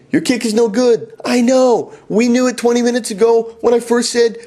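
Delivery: exasperated teacher at the end of his rope; mounting exasperation. Retold or imitated. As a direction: snap back, losing patience. Escalating frustration with student.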